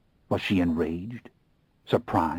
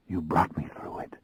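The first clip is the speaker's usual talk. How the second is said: hoarse and shaky. A narrator's reading. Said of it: "my voice hoarse and shaky".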